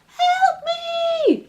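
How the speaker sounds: very high shriek of fear; trapped spider crying for help. Acted. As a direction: scream from inside the can. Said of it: "a voice cried".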